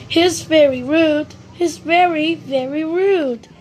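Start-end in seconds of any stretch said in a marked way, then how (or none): none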